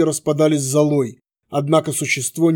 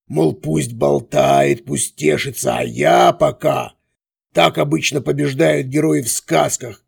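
gloating villain; slower than usual. A narrator's reading. Villain monologue.

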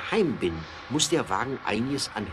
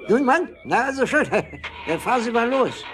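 laughing excitement; 11 semitones higher, 5 dB louder than usual. Acted. Official laughing in moving car.

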